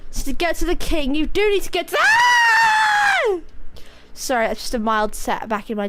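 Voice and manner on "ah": startled high shriek. Real, not acted. Sudden setback in the game.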